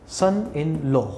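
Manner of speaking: 'लॉ' said as 'lo' with a round o; Haryanvi pronunciation. Retold or imitated. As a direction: imitate Haryanvi 'सन in लॉ' ending in 'lo'. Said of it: "Haryana वाले कैसे पढ़ते हैं".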